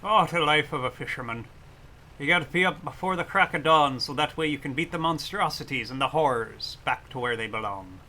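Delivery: put-on Irish accent; Irish fisherman. Gamer voicing a fisherman on early starts, Irish accent. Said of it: "my default ... this is your accent, is just Irish".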